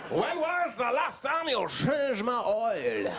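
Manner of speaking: the neglected car demanding answers; indignant car. Acted. Car scolds owner about oil, high indignant shout.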